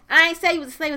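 Tammy firing back, loud; retorting shout. Retold or imitated. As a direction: snap back hard at him. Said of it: "He goes".

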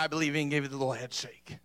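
normal talking voice

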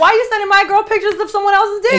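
high, loud, fast indignant outburst; hammed-up imitation of a jealous boyfriend blowing up; mock outrage. Boyfriend confronting her friend over pictures, mock outraged. Imitates him angrily starting a fight.

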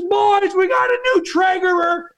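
excited call to the crew. Retold or imitated. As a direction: holler to the boys in the back. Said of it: "he was so genuinely excited".

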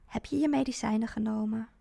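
ordinary delivery, nothing marked

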